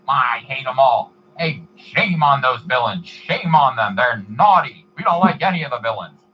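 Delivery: loud, slow showman imitation; Stan Lee impression. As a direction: scold villains with playful showmanship. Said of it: "he just immediately became Stan Lee".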